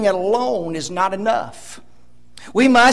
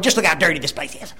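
quick, low grumble; rapid, lower put-on complaint; mock complaining; 7 semitones lower, 4 dB quieter, much faster than usual. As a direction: imitate a nitpicker griping under their breath. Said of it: "they will complain about that cobweb".

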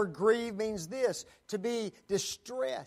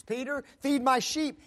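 commanding Jesus; 7 semitones higher, 7 dB louder than usual. Acted.

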